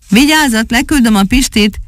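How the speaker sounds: high, lightly acted warning; warning call. A narrator's reading. Rescuer warns those below as he lowers the parrot, calling out. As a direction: call a heads-up down without real volume. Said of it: "kiáltotta Kasper".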